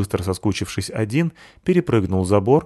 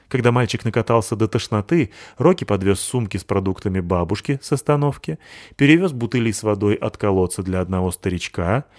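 ordinary delivery

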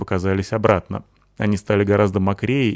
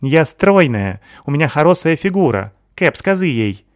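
put-on higher, lisping foreign girl voice, excited; Sykil's accented voice; 7 semitones higher, 5 dB louder than usual. Sykil praising Natasha, lisping accented girl voice.

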